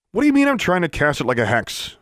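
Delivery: high-pitched, incredulous demand; indignant demand. A narrator's reading. Vexed student challenging his corrector, demanding. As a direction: throw the words back indignantly. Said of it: "Pete demanded".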